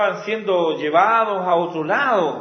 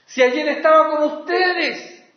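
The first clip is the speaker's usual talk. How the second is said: higher, louder, bewildered appeal; pleading apostle; 7 semitones higher, 3 dB louder than usual. Retold or imitated. Paul pleading with the fickle Galatians, bewildered. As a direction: ask it as a hurt father would. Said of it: "lo que Pablo dice".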